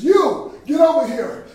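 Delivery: commanding shout at a bystander; soldier's command. Acted. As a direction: call him out sharply and loudly. Acts soldier ordering Simon.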